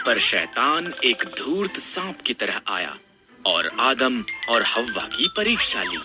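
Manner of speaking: plain reading voice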